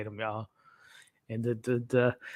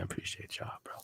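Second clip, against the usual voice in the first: whispered thanks, no voice; 18 dB quieter than usual. Real, not acted. Thanking his viewers again, in a whisper. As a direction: breathe the thanks softly.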